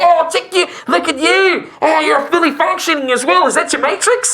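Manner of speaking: high, admiring character voice; awestruck shopkeeper. Acted. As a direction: gush over the customer, pitched far up.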